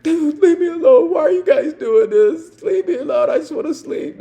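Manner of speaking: very high, loud laugh-crying whine; whining plea. Retold or imitated. Drunk to officers, high laugh-crying whine. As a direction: beg like a tired drunk, cracking up.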